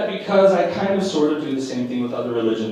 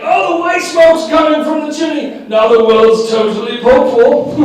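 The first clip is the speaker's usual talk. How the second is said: higher, louder thrilled reporter; excited reporter; 10 semitones higher, 6 dB louder than usual. Retold or imitated. Mocking conclave reporters, thrilled and louder. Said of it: "the reporting on site".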